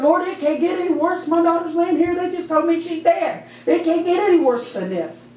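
high, pleading retold cry; desperate protest. Mother over her daughter's body, retold desperate plea.

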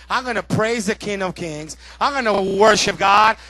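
loud, forceful sermon declaration; full-force preaching. Real, not acted. Preacher resolving to worship, loud full-force preaching.

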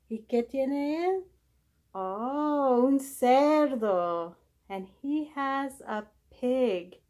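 usual speaking voice

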